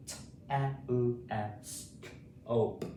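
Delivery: T, E, L, S, K, O, P as separate phonics sounds; sounded out. Shown as a demonstration. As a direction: run briskly through the first sounds, then pause between the rest. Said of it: "Say it with me".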